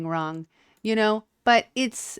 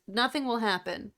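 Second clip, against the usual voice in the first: normal talking voice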